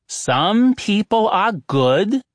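slow, deliberate "Some people are good"; slow and loud. Acted. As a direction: spell it out slowly for people who seem not to hear. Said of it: "said slowly and loudly".

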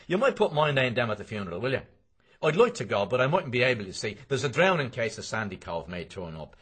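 a pushy acquaintance rattling off his request; McCoy's voice. A narrator's reading. McCoy pressing Bloom to add his name at the funeral, loud and fast.

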